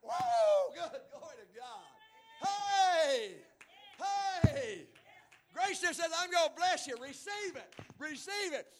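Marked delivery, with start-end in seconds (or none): 0.0-0.7 very high loud whoop; ecstatic preacher whoop. Real, not acted. Ecstatic cry. 2.4-4.9 high loud hey; ecstatic shouts. Real, not acted. Ecstatic calls. 5.5-8.7 higher and louder plea; preached offer. Acted. Grace's offer preached loud.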